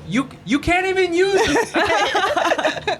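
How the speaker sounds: very high, drawn-out retold yell; mock yelling. Storyteller yelling at the Nespresso thief, high mock yell. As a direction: stretch it out high like a comic holler. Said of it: "And I yelled at him".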